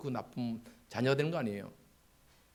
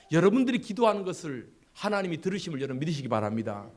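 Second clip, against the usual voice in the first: ordinary delivery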